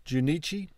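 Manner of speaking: Junichi with chi as in chia pet; questioning pronunciation. Shown as a demonstration. Learner checking how chi is pronounced, questioning tone. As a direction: softly ask Junichi, ending in a rising CHEE. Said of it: "Is it chi like a chia pet?".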